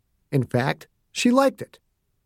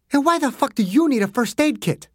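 high, snappish voice; a hint of irritation; 10 semitones higher than usual. A narrator's reading. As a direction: snap back at him. Margaret snapping at Dew.

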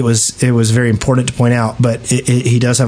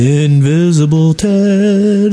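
slow, drawn-out, put-on announcer voice; drawn-out mock name; 4 semitones higher, much slower than usual. Host hamming up a listener's name, slow and drawn out.

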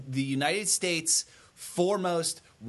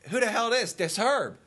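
higher pitched indignant retort; 8 semitones higher than usual. Retold or imitated. Herb snapping back at a mystery caller, indignant. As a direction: bristle at the stranger. Retold phone quarrel.

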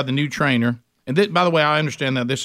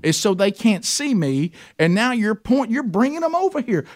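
high pleading complaint; 7 semitones higher than usual. Retold or imitated. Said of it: "I said".

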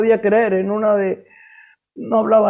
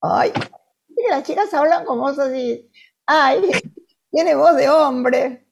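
put-on higher, sneering taunt of the kids who teased her; mocking schoolgirls; 5 semitones higher than usual. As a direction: ham up the cruel classmates, slowing on the last line. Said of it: "se burlaban feo".

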